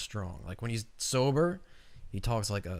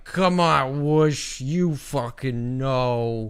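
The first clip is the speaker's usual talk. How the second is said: put-on loud, high drunken ranting; slurred drunk yelling, stretched out; 4 semitones higher, 9 dB louder, much slower than usual. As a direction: play the plastered guy yelling nonsense. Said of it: "you'll be like".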